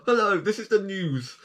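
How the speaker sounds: high, formal news announcer; newsreader voice. Pretend. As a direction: put on a mock newsreader voice. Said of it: "I'm sounding like a, uh, newsreader or summit".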